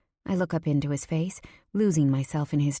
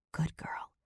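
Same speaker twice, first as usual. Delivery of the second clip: soft, intimate whisper; 10 dB quieter than usual. A narrator's reading. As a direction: whisper it close, very softly. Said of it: "he whispers".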